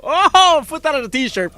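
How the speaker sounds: excited. Real, not acted. Excited exclamation.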